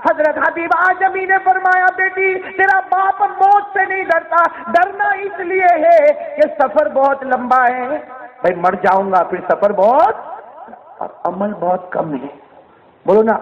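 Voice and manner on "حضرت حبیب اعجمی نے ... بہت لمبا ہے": high, slow, forceful proclamation; ringing, drawn-out preacher's cry; impassioned preaching. Acted. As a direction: stretch each phrase out from the pulpit.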